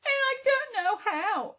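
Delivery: put-on high, tearful young girl's reply; sadly. A narrator's reading. Girl tells the little man her task, high and sad. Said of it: "replied the girl sadly".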